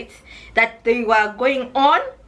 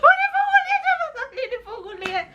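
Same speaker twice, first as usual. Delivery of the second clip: high, crying plea in Swahili; frantic begging; faster than usual. Retold or imitated. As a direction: sob and beg them to open up. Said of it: "And I was like".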